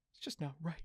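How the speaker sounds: breathless whispered despair; whispering, too upset to speak. Real, not acted. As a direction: let the words die into a whisper. Overcome, voice drops away.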